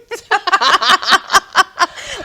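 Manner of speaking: big, high burst of laughter; loud laughing. Real, not acted. Chatting with the co-host, bursting into loud laughter. Both break up over a story.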